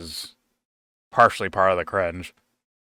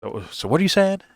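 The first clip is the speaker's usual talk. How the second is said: puzzled husband; 9 semitones higher than usual. Retold or imitated. Tom Hanks questions the coach.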